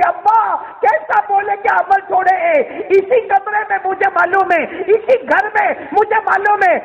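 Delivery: high-pitched imitation of a daughter shouting; preacher acting an anguished outcry; crying out. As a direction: call it out high and insistent. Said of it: "چلا چلا کے کہتی".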